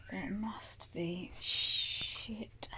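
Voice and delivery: hushed whisper, almost no voice; a private whispered curse; whispered to herself. Real, not acted. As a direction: breathe the words to herself. Searching for cat food.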